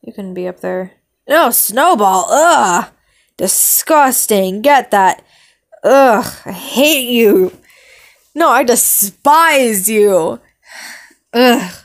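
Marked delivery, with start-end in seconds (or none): 1.3-11.7 loud hammed-up disgust and hatred; disgusted outburst. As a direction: yell in playful revulsion. Disgust at hated character.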